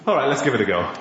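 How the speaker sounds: low-pitched, resigned giving in; reluctant agreement. Acted. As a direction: agree as worn-out fishermen with nothing to lose. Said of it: "They say".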